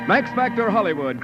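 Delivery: ordinary delivery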